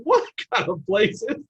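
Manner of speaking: stunned at the place; baffled quote. Retold or imitated. Freshman marveling at the rich program, baffled. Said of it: "I'm like".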